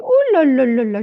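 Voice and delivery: loud alarmed exclamation. Retold or imitated. Healer walking into a room, loudly alarmed. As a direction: recoil at what you feel in the room.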